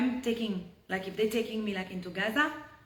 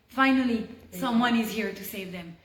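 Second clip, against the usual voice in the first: ordinary talk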